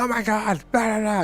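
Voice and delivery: mock freak-out, pitched high; panicky person. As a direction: play the hysterical reactor going high. Mocking a panicked, emotional reaction.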